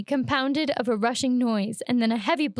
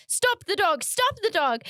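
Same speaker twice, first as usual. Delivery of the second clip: higher, urgent shout; alarmed yell; 8 semitones higher than usual. Acted. Barber shouting at a dog in his shop, alarmed yell.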